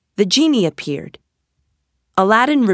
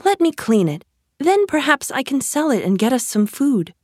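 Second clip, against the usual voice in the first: higher-pitched mother voice; lightly acted fussing mother, pitched up; Aladdin's mother; 7 semitones higher than usual. A narrator's reading. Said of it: "said Aladdin's mother".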